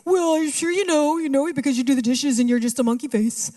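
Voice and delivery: lightly voiced teasing little brother; mock childish jab; mimicking her brother. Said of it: "it sounded something like this".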